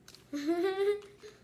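stifled giggle, mouth crammed; muffled laugh through full mouth. Real, not acted. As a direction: laugh with cheeks packed.

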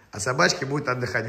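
ordinary delivery

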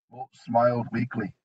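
ordinary delivery, nothing marked

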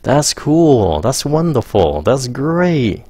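drawn-out, high excited praise; modeled reactions. Shown as a demonstration. Teacher demonstrates reactions to students, drawn-out and excited. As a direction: stretch the words like you mean them. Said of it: "Các bạn nghe".

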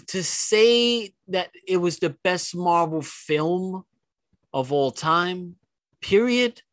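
slow, emphatic build to a high period; drawn out for emphasis. Real, not acted. Emphatic claim about best Marvel film.

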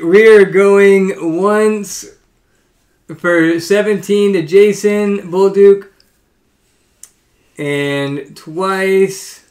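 slow, drawn-out auction call; auctioneer call. Acted. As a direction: call the bid slowly like an auctioneer. Calls the auction.

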